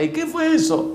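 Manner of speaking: high, soft voice of an alarmed mother; high-pitched mom reacting to a thump; mother's startled question. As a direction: squeal it softly like a mom caught off guard.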